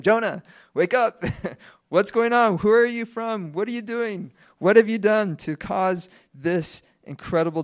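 high, loud panicked questioning; frantic sailors. Retold or imitated. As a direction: shake him awake in a panic. Sailors interrogate sleeping Jonah.

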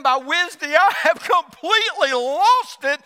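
rising to a loud, high frantic cry; hammed-up panic, all falling apart; mock frantic outburst. Preacher acting out his own midweek collapse, loud and frantic.

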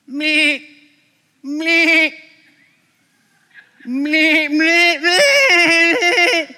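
high, loud bleating sheep noise. Pretend. Preacher imitating bleating sheep for the congregation. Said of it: "I've practiced that".

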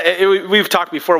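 usual speaking voice

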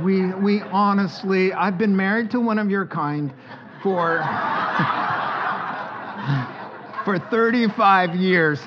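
laughing through the joke about his wife. Real, not acted. Preacher joking to the women about his wife, laughing.

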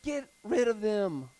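usual speaking voice

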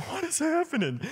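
high, bewildered "what is happening"; be the baffled teen again; stunned question. Retold or imitated. Friend preaching at camp, bewildered high question. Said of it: "You know, like".

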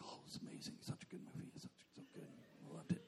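breathy hushed whisper; scared whisper. Retold or imitated. Preacher whispering to his wife during a scary film. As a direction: whisper it as if hiding being scared. Terrified, pretending to love movie.

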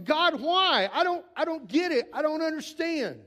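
grieving son cries out to God; anguished cry to God. Retold or imitated. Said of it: "I had those same questions".

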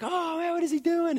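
put-on high, excited child voice; excited kids. Dad voicing his kids at the Zamboni, high and excited. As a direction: squeal it like a wowed kid.

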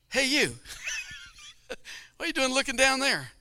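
high, loud bossy call of a lady preacher. Retold or imitated. Lady preacher scolding the boy at the altar, high and loud. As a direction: call him out like a brisk woman preacher. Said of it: "She comes over and says".

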